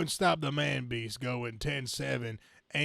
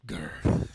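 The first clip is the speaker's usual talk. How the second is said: low unvoiced growl; mock monster growl; beast growl; 9 semitones lower, 6 dB louder than usual. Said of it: "the man beast growls".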